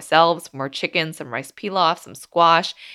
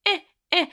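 put-on high, insistent baby grunts pointing at a snack; imitating toddler grunts; 13 semitones higher than usual. Mom imitating her son demanding the snack, high grunts. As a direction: mimic a toddler's demanding eh eh, pitched way up. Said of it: "kept pointing at it and going".

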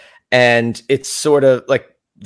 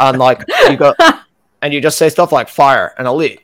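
jaw out, staccato tough-guy talk; lightly voiced staccato con man; Billy McFarland impression; 4 semitones higher, 6 dB louder than usual. Shown as a demonstration. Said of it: "talk staccato and you sort of, like, talk like this".

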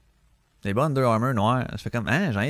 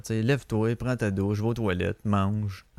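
ordinary delivery

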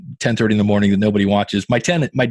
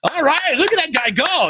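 far higher, excited cheering voice; an excited fan hollering in admiration; impressed crowd. Retold or imitated. Audience cheering his solo.

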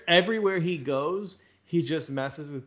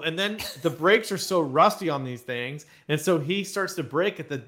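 ordinary delivery

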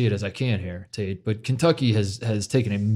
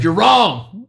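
raised, louder mock outrage; higher, indignant mock yell; 7 semitones higher, 5 dB louder than usual. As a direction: imitate an angry radio caller shouting down the hosts. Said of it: "all the rednecks called in and they were like".

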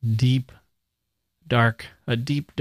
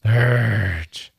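a guttural, louder urge; louder growled urge; 4 dB louder than usual. Shown as a demonstration. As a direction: let urge groan out of you. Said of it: "Is that an onomatopoeia".

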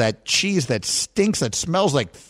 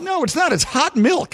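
indignant protest; 10 semitones higher than usual. Real, not acted. Arguing back and forth over broth.

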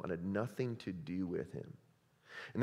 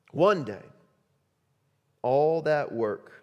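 rally them like a coach at the table; full-force preaching; 9 semitones higher, 11 dB louder than usual. Acted. Jesus's pleading, rising to a climax.

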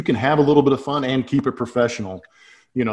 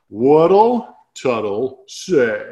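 stretched out in slow motion; slow-motion replay voice for the segment title; slow-motion effect; much slower than usual. Acted. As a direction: drag every sound out like a replay in slow motion. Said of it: "Look at the slow motion effects".

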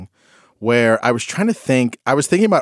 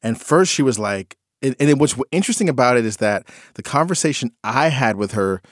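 ordinary talk, nothing marked

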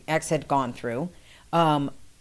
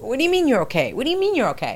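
higher, fast, rattled; retold panicked phone question; alarmed questioning; 8 semitones higher, 3 dB louder, much faster than usual. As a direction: press him, confused and scared. Panicked call, retold to host.

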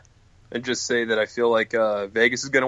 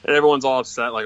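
ordinary talk

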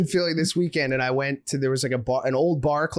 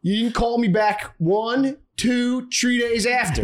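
higher-pitched imitation of an arguing Philly guy; Northeast Philly dude; 5 semitones higher than usual. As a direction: plead your case like a Philly dude, mockingly. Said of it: "just Northeast Philly dudes hanging out".

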